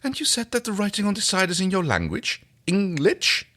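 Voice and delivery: high, gentle questioning voice; Zhaan voice. Acted. Zhaan asking John about the writing, high voice. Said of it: "We're just gonna do some voices".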